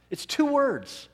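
high, loud stress on two; preacher marveling to the congregation, high and loud; emphatic two words. Real, not acted. Congregation amazed at the two words, high punch. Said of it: "rhetorical emphasis".

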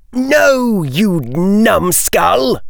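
high-pitched, drawn-out character yell. A narrator's reading. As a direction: yell it high, stretching the insult. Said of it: "yelled Draco".

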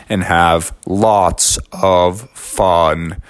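low, very slow turtle voice. Acted. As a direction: drag the words like a turtle.

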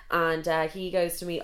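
normal talking voice

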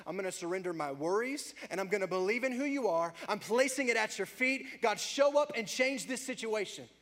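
loud, high impassioned prayer; preaching prayer. Acted. Preacher voicing a bold prayer to God, impassioned. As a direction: cry out to God at full force.

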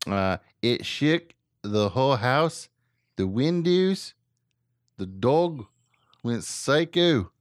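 put-on Australian accent, pitch swooping up. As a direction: read the witness in slow, exaggerated Australian. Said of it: "That's my Australian accent".

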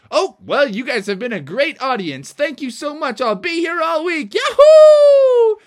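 high, loud farewell ending in a whoop; triumphant showman. Acted. Froggy thanks his audience, exuberant showman's sign-off. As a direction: play to the crowd, building to a scream.

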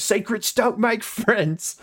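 a squeaky-pitched Cockney rat; Cockney rat puppet. Retold or imitated. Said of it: "the amazing Cockney accent".

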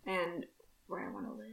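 whisper. Acted. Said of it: "that was a very deep message".

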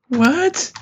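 high disbelieving what; stunned. Real, not acted. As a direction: squeak it in disbelief. Stunned by heel flipping tire.

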